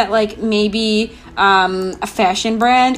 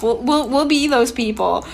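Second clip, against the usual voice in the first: ordinary talk, nothing marked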